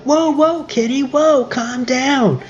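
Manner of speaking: high, pleading mock "whoa, calm down". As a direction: imitate the group holding Kenny back. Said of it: "everyone's like".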